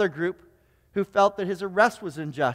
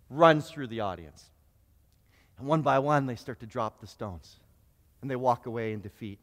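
hushed story narration; 8 semitones lower than usual. Acted. Preacher retelling the adulteress story, low and hushed.

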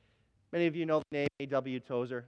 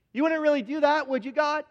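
mock disbelief; 10 semitones higher, 6 dB louder than usual. Tozer pleading in disbelief.

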